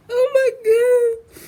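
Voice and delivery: high, tearful whine of happy disbelief; high, drawn-out, on the edge of tears; about to cry. Real, not acted. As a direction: wail it slowly, overwhelmed with joy. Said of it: "feel like I'm about to cry".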